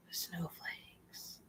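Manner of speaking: slow breathless whisper; muttered aside whispered very softly. Real, not acted. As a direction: whisper it under your breath, slowly.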